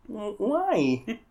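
high Kermit voice asking why; Kermit the Frog voice. Retold or imitated. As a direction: squeak it like Kermit. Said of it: "in Kermit's voice".

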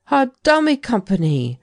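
a jolt of amazement; surprised cry. A narrator's reading. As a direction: exclaim it in sudden realisation. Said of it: "cried Talent".

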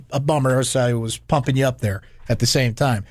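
ordinary talk, nothing marked

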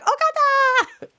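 high, slow, stretched-out Okada; drawn-out fangirl squeal. Retold or imitated. Smitten fan at Wrestle Kingdom drawing out Okada's name, high and slow. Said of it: "I was just like".

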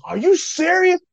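high, loud incredulous imitation; mock astonishment. Dad reacting to recruiting news, imitated high astonishment. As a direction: shoot the pitch up in acted disbelief.

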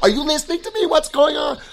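high, impatient nagging questions; annoyed wife. Retold or imitated. Wife demanding her distracted husband listen, high and impatient. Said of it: "my wife gets mad at me".

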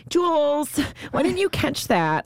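high, whiny quarterback complaint; Tom Brady. Retold or imitated. Quarterback to his receiver after a drop, high babyish whine. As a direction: whine it high, drawing out the reproach. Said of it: "his voice is to me".

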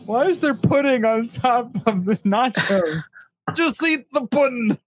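a goofy bit about pudding; Bill Cosby impression.

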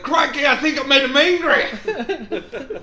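put-on slow, high, loud Aussie accent with laughter; Australian accent, slow and laughing; mock Australian. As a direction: deliver it like an Aussie croc hunter. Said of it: "I was like".

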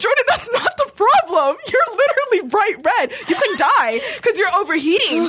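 mom scolding. Retold or imitated. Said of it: "My mom's like".

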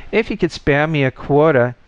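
begging. A narrator's reading. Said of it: "with a deep pretense at meaning".

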